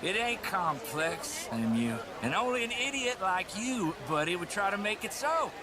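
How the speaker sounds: put-on drunk heckling. Drunk man insulting the mayor.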